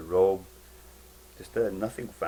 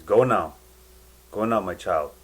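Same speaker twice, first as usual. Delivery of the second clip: loud, firm send-off; commanding go now; 2 semitones higher, 11 dB louder than usual. Retold or imitated. Jesus sends the boy back, loud firm command. Jesus sending child away.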